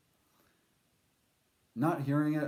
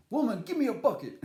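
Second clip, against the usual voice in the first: bossy cowboy voice; 8 semitones higher, 5 dB louder, faster than usual. Pretend. Demanding a bucket from the woman, hammed-up bossy. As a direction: bark it like a cartoon tough guy. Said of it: "it's fun to say it like that".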